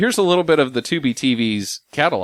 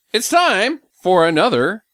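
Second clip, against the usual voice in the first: slow, higher-pitched announcer flourish; game show announcer; 4 semitones higher, slower than usual. Acted. Introducing the movie quiz to players, slow announcer voice. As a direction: announce the segment with drawn-out drama.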